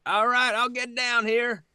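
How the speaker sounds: high, carrying shout; calling back. Acted. Player as Jens agrees to climb down, calling out. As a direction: holler your answer across the drop.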